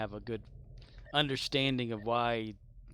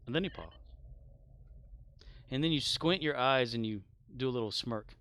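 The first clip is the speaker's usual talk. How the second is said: normal talking voice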